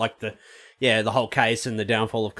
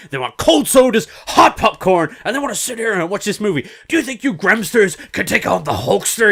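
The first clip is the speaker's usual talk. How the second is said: Hulk Hogan voice; 7 semitones higher, 5 dB louder than usual. Retold or imitated. Quoting Hogan's Gremlins speech.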